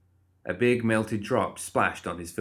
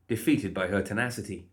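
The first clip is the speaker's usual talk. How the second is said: ordinary delivery, nothing marked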